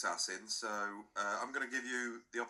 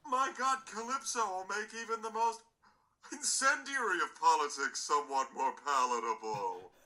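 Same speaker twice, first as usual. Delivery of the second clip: mock Caribbean accent; 10 semitones higher, 3 dB louder than usual. S0 mocking Mike Reid quote.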